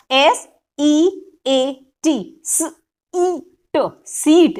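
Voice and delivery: slow, letter-by-letter spelling drill, each sound drawn out; phonics sound drill. Shown as a demonstration. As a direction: spell it out sound by sound for a class of kids. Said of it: "ये sound drill जो है, जोर से बच्चे को पढ़ानी है". (धीरे-धीरे अक्षर-अक्षर बोलकर साउंड ड्रिल)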